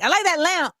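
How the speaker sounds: high, lifted compliment; finally speaking up. Retold or imitated. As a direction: pipe up with an admiring remark. Finally tells the man aloud.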